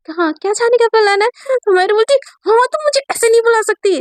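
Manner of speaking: offended objection, pitch up; annoyed protest. Acted. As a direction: push back hard at the silly nickname. Mayra's heated back-and-forth argument.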